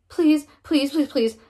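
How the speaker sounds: begging the coach not to go in; pleading. Acted. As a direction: plead with him, voice rising then sinking. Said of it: "I pleaded".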